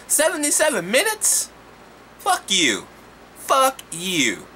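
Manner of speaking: high incredulous question then a curse; outraged scoff; mocking disbelief. Real, not acted. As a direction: sneer at the movie.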